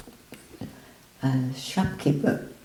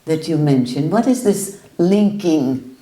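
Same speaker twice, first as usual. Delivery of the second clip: forceful questioning; 4 semitones higher, 4 dB louder than usual. Retold or imitated. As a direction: hammer the question at him. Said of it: "And he said".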